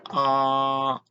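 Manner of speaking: AH held long and level; a steady, unwavering AH in Mandarin first tone. Shown as a demonstration. Saying AH as for a doctor, first tone. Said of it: "The first tone is high and level".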